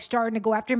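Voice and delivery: ordinary talk, nothing marked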